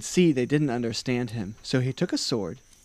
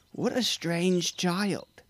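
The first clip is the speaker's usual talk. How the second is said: slow, higher-pitched puzzled villagers; villagers' disapproval; 7 semitones higher, much slower than usual. A narrator's reading. Villagers passing by remark on the deaf boy, slow and disapproving.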